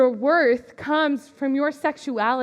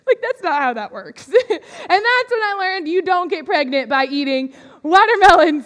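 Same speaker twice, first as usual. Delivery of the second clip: usual speaking voice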